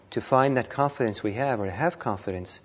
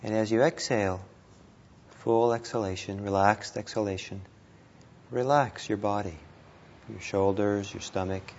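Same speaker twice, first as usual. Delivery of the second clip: guided meditation; 3 dB quieter than usual. Real, not acted. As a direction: ease them gently through each breath, unhurried.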